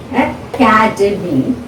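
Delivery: academy slowly divided as a-ca-de-my; syllable by syllable. Shown as a demonstration. Teacher identifying syllables for learners, academy in slow separate beats. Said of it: "So you can identify".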